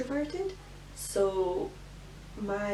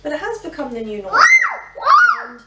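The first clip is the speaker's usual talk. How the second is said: raised voice over noise; 12 semitones higher, 13 dB louder than usual. Real, not acted. Shouting over noisy toddler.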